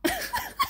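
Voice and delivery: short high-pitched giggle. Real, not acted. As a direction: let a squeaky laugh slip out. After hearing Johnny's voice. (tawa kecil melengking)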